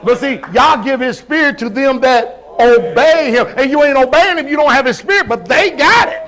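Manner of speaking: rising, loud preaching build; preaching at full force. Real, not acted. Preacher pressing the congregation, building to full force. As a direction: drive it home from the pulpit.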